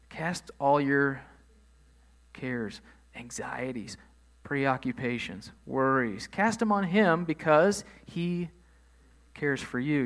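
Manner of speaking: drawn-out, deliberate reading of the verse; slow scripture reading. Acted. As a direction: lay each word down slowly, like reading from the pulpit. Reads First Peter verse slowly.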